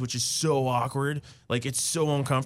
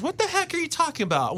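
put-on much higher, a little girl's indignant question; toddler daughter's voice; 12 semitones higher than usual. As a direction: squeak it up like a puzzled toddler. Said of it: "And she goes".

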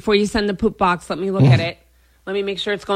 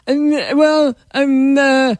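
halting, vacant mock mumble; dopey boyfriend; 5 semitones higher, slower than usual. Boyfriend asked about breaking up, dopily dithering. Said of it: "this is how my boyfriend sounds to me".

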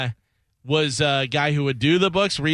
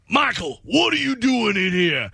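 higher, mock old judge; 7 semitones higher than usual. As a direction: play the surprised judge lightly. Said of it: "all the different voices".

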